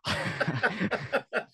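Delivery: amused burst of laughter. Real, not acted. Co-host laughing at friend's bleak joke, amused laugh.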